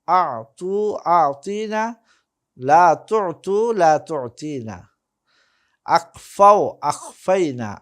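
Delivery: slow conjugation drill, each form starting strong; emphatic, sing-song classroom recitation; model recitation. Shown as a demonstration. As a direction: stretch the long vowels for students to repeat. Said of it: "আমি একবার করে উচ্চারণ করে দিব".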